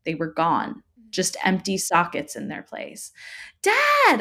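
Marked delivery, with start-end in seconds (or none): 3.6-4.2 very high, loud cry for dad; desperate shriek. A narrator's reading. Child trapped with an eyeless figure, desperate shriek. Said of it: "I shrieked a, s-, a second time".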